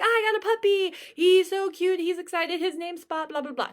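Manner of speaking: put-on excited teen girl. Student telling her teacher about her new puppy, excitedly. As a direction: gush like a kid with a new puppy. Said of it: "She's gonna be like".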